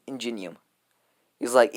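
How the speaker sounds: usual speaking voice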